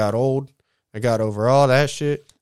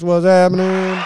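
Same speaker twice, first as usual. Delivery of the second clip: much higher and louder, shouted out; hyped shout; 7 semitones higher, 7 dB louder than usual. Real, not acted. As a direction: holler it like calling to the whole crowd. Shout out to the 909.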